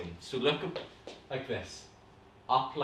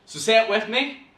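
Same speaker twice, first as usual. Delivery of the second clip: usual speaking voice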